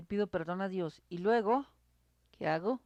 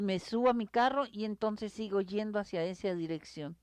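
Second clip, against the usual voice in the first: ordinary talk, nothing marked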